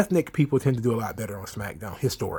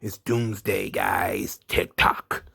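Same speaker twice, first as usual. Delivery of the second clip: imitating his usual line, trailing into a low breathy growl; Kross catchphrase; 5 semitones lower, 3 dB quieter, much slower than usual. Wrestler Kross delivering his catchphrase, lightly imitated growl. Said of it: "Kross does his usual".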